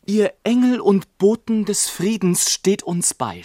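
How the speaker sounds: hoch, flehend gerufen; verzweifelter Schrei. A narrator's reading. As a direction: fleht die Engel laut an. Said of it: "schrie mein Vater". (hoher, flehender Ausruf des Vaters)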